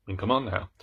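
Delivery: ordinary delivery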